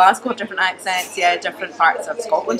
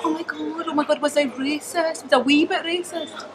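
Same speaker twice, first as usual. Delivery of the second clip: mock panic; 10 semitones higher than usual. Woman caught out, fretting in a hammed-up panic, high. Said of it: "And she's like".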